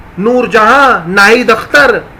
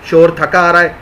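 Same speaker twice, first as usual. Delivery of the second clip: normal talking voice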